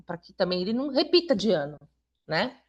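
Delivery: ordinary delivery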